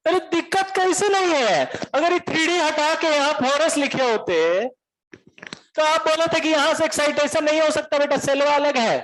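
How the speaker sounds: heated Bihari voice arguing with the teacher; agitated Bihari student. Retold or imitated. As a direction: protest like a worked-up Bihari student. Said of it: "तो काहे चिल्ला रहे इतना".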